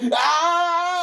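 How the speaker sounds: long, high, loud howl of pain; drawn-out shrieked "ow"; yell of pain. A narrator's reading. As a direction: yelp as if just struck. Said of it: "and he yelled".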